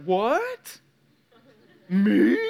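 incredulous questions with a sharp upward lilt; mock disbelief. Client told his sarcasm is anger, mock disbelief. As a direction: play innocent, each word rising into a question. Feigned shock at counselor's diagnosis.

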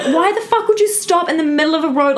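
furious at the driver blocking the road; exasperated retold outburst. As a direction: vent at the rude driver, pitch up. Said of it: "But I'm like".

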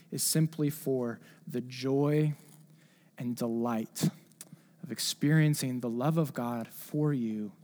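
soft, slow, reverent; tender and slow. Real, not acted. Preacher dwelling tenderly on God's love for his child. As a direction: let it sink in gently.

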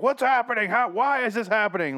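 high, loud cry of bewilderment; mock panic. Host imitating passenger carried off the plane, mock panic. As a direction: wail it like a hammed-up victim.

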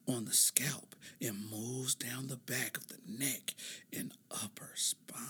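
slow mock ASMR whisper; drops into a slow teasing whisper. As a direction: whisper the definition slowly like an ASMR clip.

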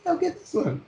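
very high-pitched imitated child; kid customer. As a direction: squeak it out like a kid won over. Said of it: "eventually they'll just be like".

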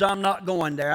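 ordinary delivery

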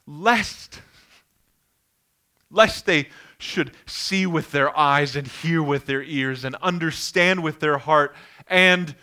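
measured, building scripture read, slowing to the end. Acted. Preacher reading Isaiah to the congregation, building in weight. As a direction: read it like prophecy, rising to a climax.

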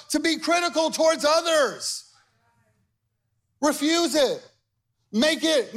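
rally them to refuse; fervent preaching. Acted. Pastor urging the church to refuse criticism, fervent preaching.

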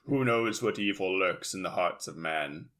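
put-on low, ominous old radio narrator; The Shadow. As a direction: brood like a pulp radio hero. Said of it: "it's very The Shadow".

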